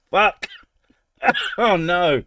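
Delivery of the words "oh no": ordinary delivery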